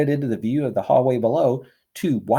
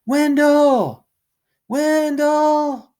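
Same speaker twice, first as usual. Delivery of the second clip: calling out high and much louder; yelling a name; 6 dB louder, much slower than usual. Acted. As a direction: shout across the lawn with cupped hands. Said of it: "cupped his hands around his mouth and yelled".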